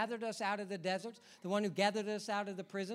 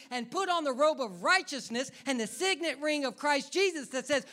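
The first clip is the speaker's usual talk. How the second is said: loud, higher fiery preacher's cry; preaching at full force; 6 semitones higher, 8 dB louder than usual. Real, not acted. Preacher urging the congregation to live free, full force. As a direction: rise up and preach it hard. Preaching climax, voicing God.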